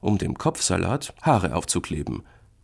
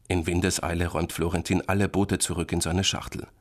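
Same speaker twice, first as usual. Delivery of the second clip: ordinary delivery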